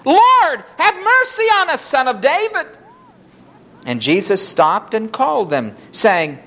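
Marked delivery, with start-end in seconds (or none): 0.0-2.7 crying out harder. A narrator's reading. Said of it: "they cried out the more".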